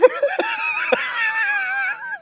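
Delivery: high squealing laugh. Real, not acted. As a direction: burst into a shrieking laugh. Laughing after mock scream.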